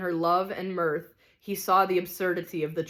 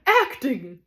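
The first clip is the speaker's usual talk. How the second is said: loud, higher outraged shriek; screamed; 6 semitones higher, 6 dB louder than usual. Acted. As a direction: scream the word back. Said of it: "screamed the tragedian".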